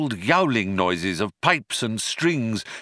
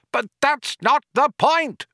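higher, louder furious outburst; raging; 14 semitones higher, 4 dB louder than usual. Acted. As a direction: rage at the voice. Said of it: "raged Ford".

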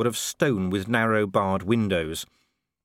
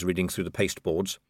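normal talking voice

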